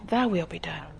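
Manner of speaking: soft, hushed whisper of yielding; softly whispered prayer; whispered surrender prayer. Shown as a demonstration. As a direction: breathe the words out as a quiet prayer. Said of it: "You gotta say".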